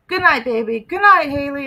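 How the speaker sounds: pestering goodnight; pushy needy voice. Retold or imitated. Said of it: "He said".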